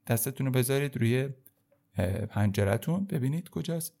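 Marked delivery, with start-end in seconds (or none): none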